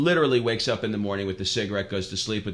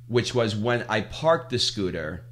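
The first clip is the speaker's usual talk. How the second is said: normal talking voice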